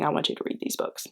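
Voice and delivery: fast, breathy plea in a whisper; intense whisper. Acted. Booktuber begging viewers, fast whisper. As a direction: urge them in a hushed rush.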